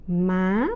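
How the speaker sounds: "má." climbing sharply at the end; high rising tone. Shown as a demonstration. Teacher showing learners the third tone, high rising. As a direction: sweep "má." upward to a high finish. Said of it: "high rising tone".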